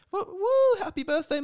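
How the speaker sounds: high falsetto whooping; mock birthday cheer. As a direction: play a squealing party crowd cheering. Acting out birthday well-wishers.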